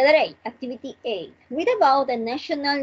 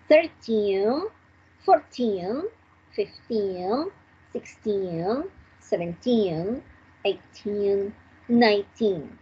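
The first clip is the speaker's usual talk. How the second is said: put-on each "teen" stretched out, mostly rising like a list; stress on the ending. Shown as a demonstration. Teacher repeating the teen numbers for students, stressed endings. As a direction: draw out and lift the final "teen". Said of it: "We emphasize in ten".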